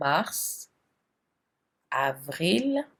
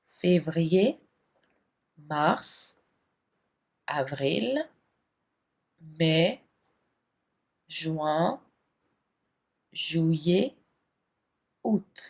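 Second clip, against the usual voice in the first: slow list of months, each with a rising tune; drill recitation; much slower than usual. Shown as a demonstration. Teacher recites the French months for students to repeat.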